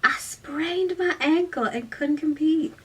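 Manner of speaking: high-pitched Southern belle; put-on Southern accent. A narrator's reading. Girl inventing an injury, high Southern drawl. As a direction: plead an excuse in a Southern drawl. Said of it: "You're a cheerleader from the South".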